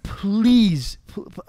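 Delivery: loud pleading please; urgent plea. Real, not acted. Host begging viewers thinking of leaving, loud plea. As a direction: cry out the please to the listener. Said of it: "I'm gonna need you to listen".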